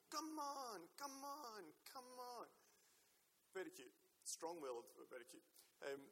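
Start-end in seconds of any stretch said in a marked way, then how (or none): 0.1-2.5 put-on high, bossy little-girl call; dad imitating his toddler daughter bossing her twin, high and eager. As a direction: coax like a bossy one-and-a-half-year-old. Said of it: "she'll do this with her hand and go".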